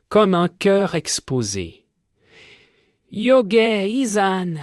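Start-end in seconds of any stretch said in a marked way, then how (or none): 3.1-4.5 high, slow cry of the name. Acted. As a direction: call her name out high and drawn out. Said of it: "crie-t-il". (cri aigu et lent)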